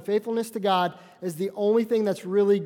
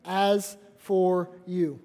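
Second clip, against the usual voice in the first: very slow, each word of As for you spaced; half-solemn pointed call-out; slow pointed address; much slower than usual. Retold or imitated. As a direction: drag out As for you deliberately. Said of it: "and says".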